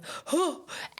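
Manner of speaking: high delighted gasp; excited gasp. Retold or imitated. Photographer reading praise email, excited gasp. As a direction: catch your breath in joy.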